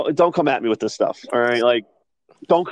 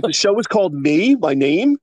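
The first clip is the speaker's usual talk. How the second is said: higher-pitched, questioning lift on Me; incredulous confusion; 6 semitones higher than usual. Retold or imitated. Anchor thinking the show bears her name, confused. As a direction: imitate an anchor puzzled by the title.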